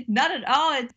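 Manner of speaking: laughing high as she answers; amused laugh bubbling into the words. Real, not acted. Guest laughing off the host's question, laughing. Host asks about her hometown.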